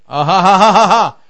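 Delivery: long run of ha-ha laughter; triumphant laugh. Real, not acted.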